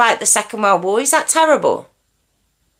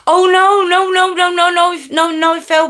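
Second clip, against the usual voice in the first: alarmed no; 9 semitones higher than usual. Real, not acted. Reacting to Phil's chat comment.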